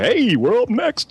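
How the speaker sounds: slow, chirpy announcer imitation; mock TV promo hosts. Mocking comedians in the corner of the screen, slow and chirpy. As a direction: play the cheesy promo hosts slowly. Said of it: "two fuckers ... in the corner".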